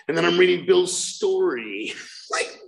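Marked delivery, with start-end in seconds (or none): none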